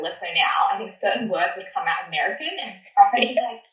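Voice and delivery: ordinary talk, nothing marked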